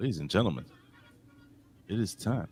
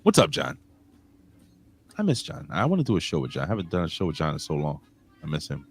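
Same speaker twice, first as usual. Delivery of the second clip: ordinary delivery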